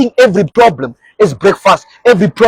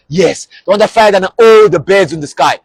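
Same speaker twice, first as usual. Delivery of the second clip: usual speaking voice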